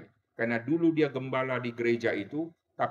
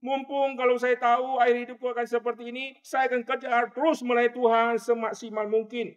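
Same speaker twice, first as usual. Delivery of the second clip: dying woman's cry; 10 semitones higher, 4 dB louder than usual. Retold or imitated. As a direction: wail her regret before death. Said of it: "dia teriak begini". (wanita sekarat berteriak menyesal)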